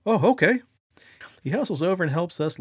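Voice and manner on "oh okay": loud, high startled reply; startled cop. Retold or imitated. Cop suddenly ordered to help carry a casket, startled. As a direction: blurt it out, caught off guard. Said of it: "to our utter astonishment, the police officer goes".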